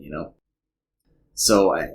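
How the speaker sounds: ordinary talk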